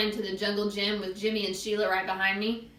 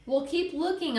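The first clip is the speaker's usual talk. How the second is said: pitched-up but restrained yell; mock yell; 8 semitones higher than usual. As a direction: order her on impatiently. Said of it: "I yelled".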